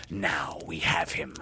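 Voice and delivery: ordinary delivery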